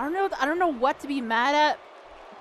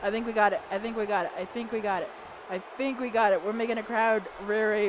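ordinary delivery

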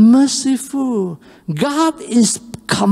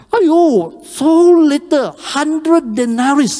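ungrateful servant mocking a small debt; scornful dismissal; 7 semitones higher, slower than usual. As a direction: sneer at the tiny sum.

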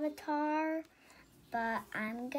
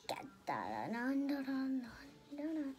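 whisper; 4 dB quieter, faster than usual. Real, not acted. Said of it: "whispered humming".

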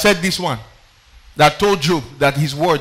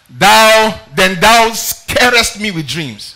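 slow loud scripture reading; 4 semitones higher, 8 dB louder, much slower than usual. Acted. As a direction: stretch each word, loud and slow. Reading Job's lament aloud.

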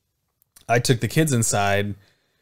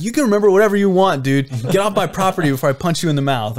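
loud, raised, fed-up comeback; angry threat; 7 semitones higher, 5 dB louder than usual. Retold or imitated. Neighbor threatening to punch the drunk guy, angrily. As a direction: warn him off forcefully.

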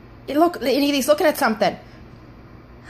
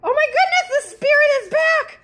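excited; 13 semitones higher, 3 dB louder than usual. Real, not acted. Spirit returns, excited.

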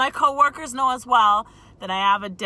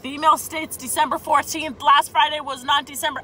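exasperated; 5 semitones higher, 5 dB louder than usual. Retold or imitated. Retold argument with coworker.

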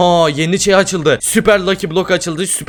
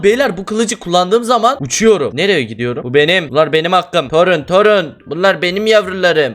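ordinary talk, nothing marked

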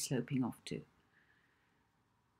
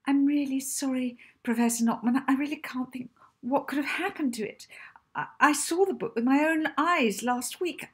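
high, louder, flustered and apologetic; nervous piping voice; 10 semitones higher, 6 dB louder than usual. A narrator's reading. Librarian apologising to the angry professor, nervous high piping. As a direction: pipe up like a frightened little wren. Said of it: "The librarian's wren-like voice piped up nervously".

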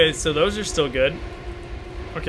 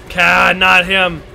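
usual speaking voice

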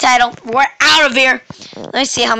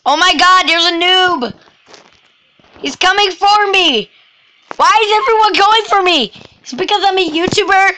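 high, fast frantic complaint; panicked; 6 semitones higher than usual. Real, not acted. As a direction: rush it out in a panic. Chased by noob.

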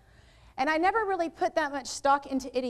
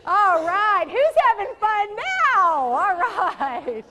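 high, very loud rallying yell; ear-splitting shout; 7 semitones higher, 10 dB louder, much slower than usual. Real, not acted. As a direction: holler it out to rouse the whole room. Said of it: "his eardrums are about to explode".

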